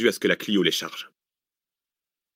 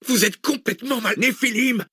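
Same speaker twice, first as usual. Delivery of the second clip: high-pitched outburst of rage and fear; enraged and frightened; 14 semitones higher than usual. A narrator's reading. Passenger at the driver during a car chase, enraged and frightened. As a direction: cry out in panicked anger, pitched way up. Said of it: "De rage, de peur, de colère".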